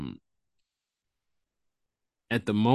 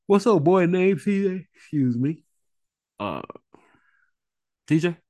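calling out; 9 semitones higher, 4 dB louder than usual. Real, not acted. Greeting someone joining the call.

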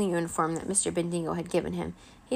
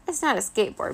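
ordinary delivery

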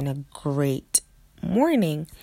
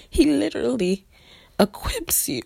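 high, breaking whisper through real tears; tearful praise, voice giving way; crying; 9 semitones higher than usual. Real, not acted. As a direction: overcome with gratitude, barely getting words out. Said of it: "I'm about to tear up".

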